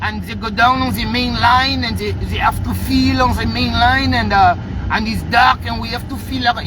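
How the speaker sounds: put-on hesitant diver with a French accent. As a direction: mimic a halting French-accented explanation, full of uhs. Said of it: "The man got the diver talking".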